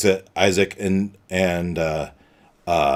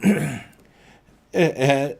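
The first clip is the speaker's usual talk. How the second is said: ordinary delivery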